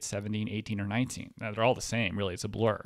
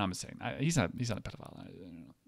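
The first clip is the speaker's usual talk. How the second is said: usual speaking voice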